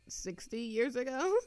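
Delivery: usual speaking voice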